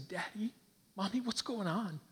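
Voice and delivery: put-on high, small child voice; worried little child. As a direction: play a puzzled child asking parents. Child waiting on hospital table.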